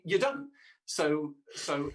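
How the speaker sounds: usual speaking voice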